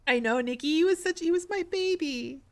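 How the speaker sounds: high-pitched, tearful lament; real emotional upset. Real, not acted. Streamer to Nikki about the late cat, tearfully emotional. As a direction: let the voice climb high with grief. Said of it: "I'm getting a little emotional".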